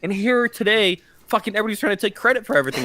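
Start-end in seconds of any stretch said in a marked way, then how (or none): 1.3-2.8 very fast mock indignant rant; fast exasperated grumble; rushed complaint. Real, not acted. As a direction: rattle it off in mock outrage. Mock complaint about credit.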